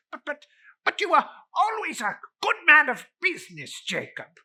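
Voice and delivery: put-on high, fast, stuttering old miser; frightened Scrooge stammering up high; stammering fear. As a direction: babble it out in terror.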